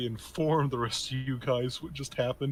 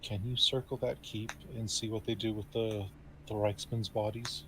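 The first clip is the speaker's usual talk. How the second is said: soft, hushed voice, slightly low; a lightly acted confidential murmur; whispered request; 3 semitones lower, 7 dB quieter than usual. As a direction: ask discreetly, for one listener only. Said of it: "and whisper to him".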